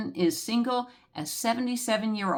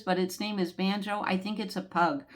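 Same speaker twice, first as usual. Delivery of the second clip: normal talking voice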